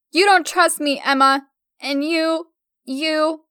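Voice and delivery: high, loud, slowing whine; a girl whining toward crying. A narrator's reading. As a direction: whine it close to tears. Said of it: "Her whines turned into soft cries".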